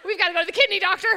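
fast mock panic; panicked rush. Panicked mom reaction.

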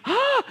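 sudden high yelp of shock; a startled cry at the ruined driveway. Retold or imitated. Man sees boy in concrete.

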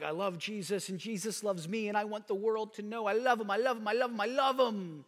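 high, loud, eager declaration of love; gushing believer. Pretend. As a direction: gush like a smitten new believer. Believer gushing about loving Jesus.

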